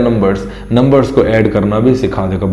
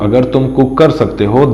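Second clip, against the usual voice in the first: ordinary talk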